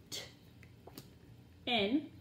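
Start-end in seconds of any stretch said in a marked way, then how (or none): 0.1-0.2 T as a tiny voiceless tick "t"; letter sound. Shown as a demonstration. Teacher drilling letter sounds, T as bare "t". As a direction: tick T with no vowel.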